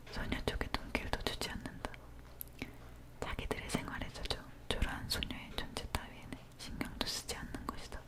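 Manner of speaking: usual speaking voice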